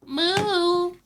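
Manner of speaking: high, loud, drawn-out moo; cow moo. Pretend. Streamer trying out a new accent, high loud cow moo. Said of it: "I found my new accent".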